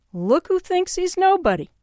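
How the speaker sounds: high, sniffy put-on rabbi voice; scoffing punchline.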